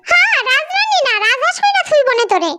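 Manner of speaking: high-pitched nagging mother voice; scolding mother. Acted. Mother scolds her daughter about housework, high-pitched and nagging.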